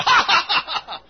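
high, loud spelled-out laugh; imitated laugh. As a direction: mimic the man's happy ha ha. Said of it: "I said".

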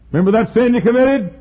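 loud, high-pitched taunting accusation; accusing devil. Acted. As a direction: throw it at him as the devil. Devil accusing Luther in story.

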